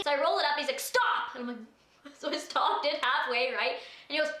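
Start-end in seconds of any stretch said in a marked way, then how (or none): 0.8-1.3 high, abrupt mock order; sudden command. Cop halting the driver as the window rolls up, abrupt. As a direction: imitate a sharp halt command.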